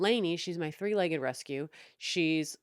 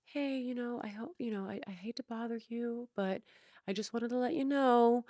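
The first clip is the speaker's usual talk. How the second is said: polite Dennis; 6 semitones higher than usual. Retold or imitated. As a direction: tiptoe around it like a meek nice guy. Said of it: "Dennis isn't I like".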